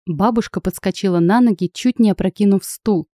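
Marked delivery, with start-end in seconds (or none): none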